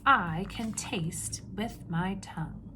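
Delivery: ordinary talk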